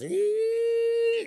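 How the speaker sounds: high squealing electronic beep; shrill biofeedback tone; machine beep. Retold or imitated. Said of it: "and then it goes".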